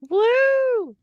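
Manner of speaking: high loud whoop. Acted. Player heading to the arena, a high loud whoop. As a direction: let out a happy whoop.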